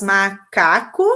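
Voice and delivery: slow, split macaco into ma-ca-co; syllable by syllable. Shown as a demonstration. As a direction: draw out macaco into three syllables. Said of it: "que tem três sílabas".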